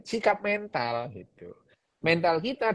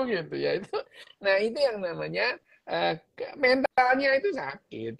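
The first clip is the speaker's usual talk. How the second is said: usual speaking voice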